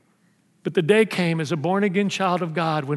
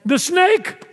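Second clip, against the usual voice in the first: Eve's answer; 10 semitones higher than usual. Acted. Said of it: "you know what she says".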